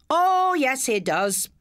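high, loud pantomime shout; panto call-out. A narrator's reading. Pupil calls out to the class like panto crowd, loud and high. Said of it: "as they do in the panto".

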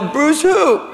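high, gasping question on BRUCE WHO; starstruck disbelief. Acted. Fan asking the caller about her husband, high disbelief.

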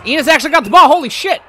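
very high, loud astonished shout; surprised shout. Real, not acted. Gamer shocked by a catch, shouting high and loud.

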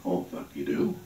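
low, spooked stoner mutter; mock paranoid fear. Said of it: "So now I'm paranoid".

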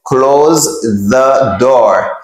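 word-by-word CLOSE, THE DOOR; Z-sound pronunciation. Shown as a demonstration. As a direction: CLOSE THE DOOR slowly, CLOSE ending in Z. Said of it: "li gen son z".

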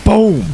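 loud, high explosion noise; shouted boom. Pretend. Player scoring a kill, shouted boom. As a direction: fire off a short, punchy boom.

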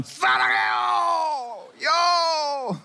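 very high, drawn-out comic holler; mock love cry, hugely prolonged; yelling lover. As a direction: stretch each word into a long shout. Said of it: "Lovers don't yell".

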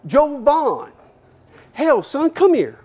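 high, loud Texas boss calling out. Retold or imitated. Texas company owner yells for his young employee, high loud country voice. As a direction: yell it like a good-old-boy owner from the front office.

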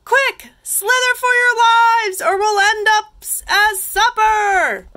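high, loud storybook alarm; frantic snails, last words stretched out; panicked cry. A narrator's reading. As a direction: shout for everyone to flee. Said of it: "cried the snails in a panic".

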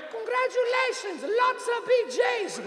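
very high, slow mother voice; imitated mother. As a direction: draw out Mom's congratulations in a high put-on voice. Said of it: "My mother wrote".

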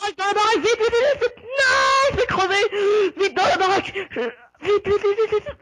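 high shouted frantic cries; panicking gamer. Real, not acted. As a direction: yell in near-crying panic. Fleeing into the house.

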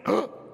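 sharp mock gasp; shocked gasp. Lady behind us seeing the kid cut in, mock gasp. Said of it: "this lady behind us who went".

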